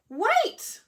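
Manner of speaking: loud, high cry of Wait!. Acted. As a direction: call out to stop him. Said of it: "cried the smallest billy goat Gruff".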